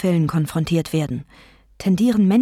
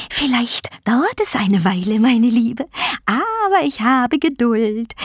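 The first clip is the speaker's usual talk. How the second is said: taunting vulture goddess; 9 semitones higher, 3 dB louder than usual. Acted. Vulture goddess promising patience to her prey, taunting. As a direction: gloat with big pitch swoops, stretching words.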